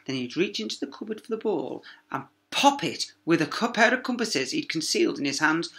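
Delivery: plain reading voice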